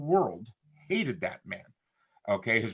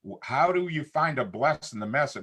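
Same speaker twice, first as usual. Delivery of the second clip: normal talking voice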